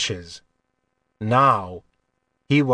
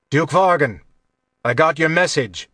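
raised voice, higher pitch; loud greeting; 3 semitones higher, 3 dB louder than usual. A narrator's reading. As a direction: greet him loudly enough to be overheard. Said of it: "Kylar said loudly".

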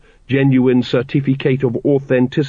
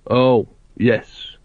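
gloomy. A narrator's reading. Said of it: "said Vimes gloomily".

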